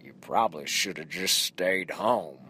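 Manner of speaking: put-on low, deadpan warning from an old barfly; lightly voiced wizened old man; dry old guy quote. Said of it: "side-eyed me and said".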